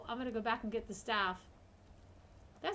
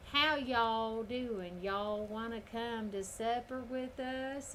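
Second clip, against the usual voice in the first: slow drawn-out Georgia drawl; deep South accent; much slower than usual. Retold or imitated. Streamer imitating deep South hospitality, slow Southern drawl. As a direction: stretch it out like a Georgia host. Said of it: "you get down into the deep South".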